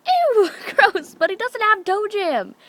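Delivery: high-pitched grossed-out protest; disgusted. Retold or imitated. Teller reacts to cousin showing dad's toe, disgusted. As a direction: squeal in comic disgust.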